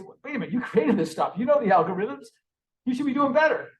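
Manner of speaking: indignant challenge. Retold or imitated. Users scolding the makers of the detector, indignant. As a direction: challenge them indignantly, pitch climbing to the end.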